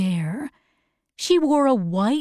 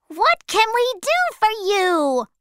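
very high, eager little creature; monkey voice; 11 semitones higher than usual. Acted. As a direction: offer help in a squeaky-high monkey voice. A monkey asks Dorothy.

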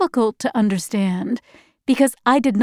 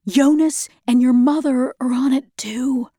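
whisper; 2 semitones higher than usual. Acted. Secret warning in ration line.

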